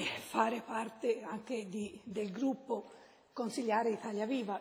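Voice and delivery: usual speaking voice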